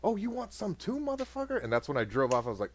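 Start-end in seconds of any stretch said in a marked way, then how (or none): 0.0-1.6 angry mom. Retold or imitated. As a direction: play the furious mother, high and shrill. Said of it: "she's like".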